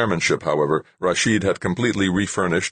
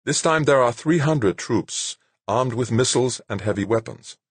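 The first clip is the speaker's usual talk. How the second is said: smiling Yamagata; 7 semitones higher than usual. A narrator's reading. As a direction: explain with a calm smile. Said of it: "Yamagata smiled again".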